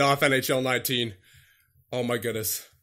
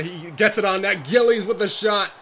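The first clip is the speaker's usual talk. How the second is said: rising, louder shot call; excited play-by-play; 5 semitones higher, 4 dB louder than usual. Real, not acted. Player commentates Gillies shooting on net, rising excitement. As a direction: build quickly as the shot comes.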